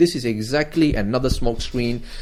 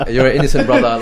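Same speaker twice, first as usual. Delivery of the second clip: louder, speaking through laughter; laughing affectionate teasing; 3 semitones higher, 8 dB louder, faster than usual. Real, not acted. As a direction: tease him while still laughing.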